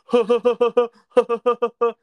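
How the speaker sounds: high, loud jeering laugh imitated; mocking laugh. Mennonite boy mocking the guys in the car, jeering laugh. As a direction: jeer at them with a fake laugh. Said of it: "laughing like he was mocking us".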